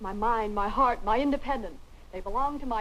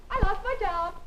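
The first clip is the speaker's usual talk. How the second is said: high-pitched, elated announcement; gleeful announcement; 8 semitones higher, 3 dB louder than usual. Acted. Linda telling family she lost her job, gleeful. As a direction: announce it like great good news.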